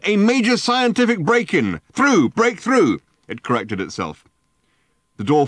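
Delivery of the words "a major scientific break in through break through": high, loud megaphone voice; megaphone bark. A narrator's reading. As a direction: bark it through a loudhailer. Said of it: "barked the megaphone".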